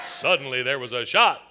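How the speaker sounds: low, slow storyteller voice; ominous story narration. Shown as a demonstration. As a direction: drop the pitch and draw out the cue. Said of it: "I'm gonna say these words".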